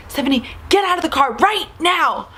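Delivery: imitated scolding order, punched words; angry mom. Mom orders her daughter out of the car, angry.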